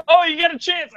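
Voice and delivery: high, mocking Italian dad voice; sarcastic imitation of a pushy dad. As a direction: lampoon the pushy father.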